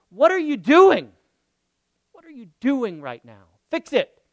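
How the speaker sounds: loud sharp demand, rising high; exasperated demand. Retold or imitated. Retold demand, loud and fed up. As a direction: bark it like fed up with someone.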